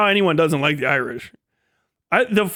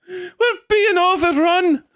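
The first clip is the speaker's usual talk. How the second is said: put-on alarmed old Irishman; 12 semitones higher, slower than usual. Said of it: "the old man".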